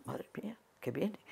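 hushed alarm under her breath; retold frightened murmur. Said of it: "Yo digo". (murmullo asustado y bajo)

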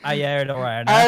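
put-on loud, hammy Australian accent; overdone Australian twang shouted out; bad Australian accent. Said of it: "a really bad Australian accent".

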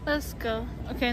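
ordinary delivery

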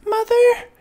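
tiny, very high squeak; high-pitched little squeal of a call; squeaky baby bat. A narrator's reading. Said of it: "Stella Luna squeaked".

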